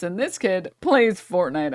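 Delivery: usual speaking voice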